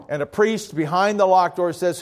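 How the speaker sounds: ordinary talk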